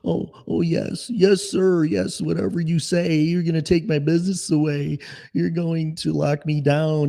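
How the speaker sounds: high, meek yes-sir whine; mock submissive. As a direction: grovel in a high, simpering voice. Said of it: "as Americans and go".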